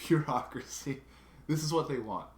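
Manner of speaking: laughing exasperation. Real, not acted. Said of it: "stupid bureaucracy".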